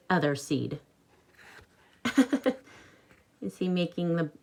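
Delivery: usual speaking voice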